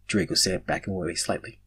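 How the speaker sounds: ordinary delivery, nothing marked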